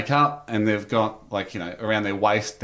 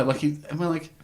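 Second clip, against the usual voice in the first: ordinary talk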